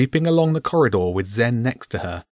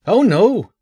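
mocking; 11 semitones higher, 6 dB louder than usual. A narrator's reading. Guard mocks the bribe.

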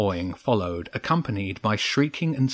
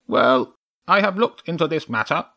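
lightly voiced crow councillor, higher; Nigel the crow; 6 semitones higher, 3 dB louder than usual. A narrator's reading. Nigel reporting to the chairman, lightly voiced higher crow. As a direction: report a little higher than usual.

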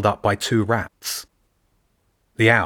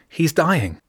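hopeless; 8 semitones higher than usual. Acted. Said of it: "hopelessness clinging to her voice".

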